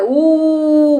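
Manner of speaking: ऊ held long; long vowel. Shown as a demonstration. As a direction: stretch ऊ out steadily. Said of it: "इसके उच्चारण में ज़्यादा समय लगता है". (लंबा खींचा हुआ ऊ)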